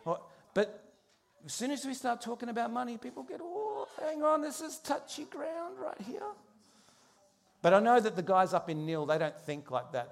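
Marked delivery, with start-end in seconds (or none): 3.4-6.2 high, far-off put-on voice of a worried churchgoer; nervous churchgoer. Churchgoer flinching when the pastor mentions money, high nervous voice. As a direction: squeak it like an uneasy congregant.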